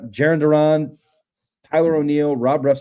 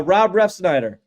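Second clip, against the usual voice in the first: ordinary delivery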